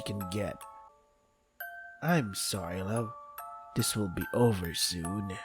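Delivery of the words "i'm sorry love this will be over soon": tender. Acted. Mai soothing while strangling.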